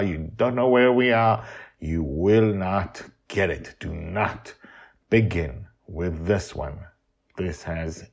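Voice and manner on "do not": usual speaking voice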